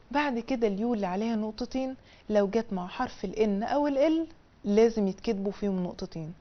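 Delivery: ordinary talk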